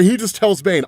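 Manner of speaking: ordinary delivery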